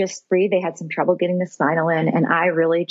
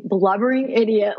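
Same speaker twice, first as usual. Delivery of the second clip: ordinary talk, nothing marked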